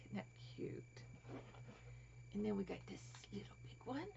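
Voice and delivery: soft quick whisper; hushed delighted aside. Real, not acted. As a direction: breathe it out. Whispering over toys.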